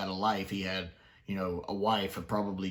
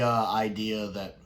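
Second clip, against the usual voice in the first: ordinary delivery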